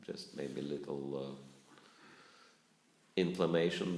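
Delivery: ordinary talk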